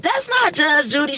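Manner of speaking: put-on high toddler voice; lightly voiced toddler; little boy voice. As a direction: imitate the small child again.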